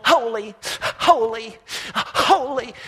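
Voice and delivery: loud, building excited calls; eager holy cries. A narrator's reading. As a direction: cry it out like straining sled dogs. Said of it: "The wings and the wheels are going".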